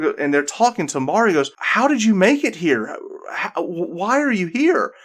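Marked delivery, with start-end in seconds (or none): 1.6-4.9 high-pitched, stammering disbelief; bewildered questioning. Acted. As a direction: stumble over the question in astonishment. Game master acts bewildered character's questions.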